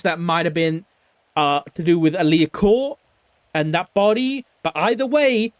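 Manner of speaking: ordinary talk, nothing marked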